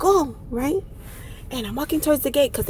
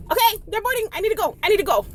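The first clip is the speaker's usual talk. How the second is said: higher-pitched mild panic; panicking; 7 semitones higher, faster than usual. Retold or imitated. Traveler hearing the last boarding call, mildly panicking. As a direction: play it as mounting urgency. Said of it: "I'm already panicking".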